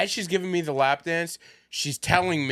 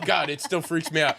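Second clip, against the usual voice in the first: ordinary talk, nothing marked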